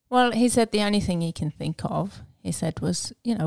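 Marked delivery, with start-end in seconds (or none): none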